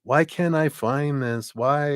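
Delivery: high, plaintive whining why; hammed-up woe-is-me; mock despair. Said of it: "have your head down and go".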